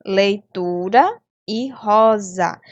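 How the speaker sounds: "leitura" and "rosa" very slow, leaning on TU and RO; stressed penultimate syllable. Shown as a demonstration. Teacher giving third-graders examples "leitura" and "rosa", slowly stressing the penultimate syllable. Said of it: "Percebam que a sílaba tônica está em destaque".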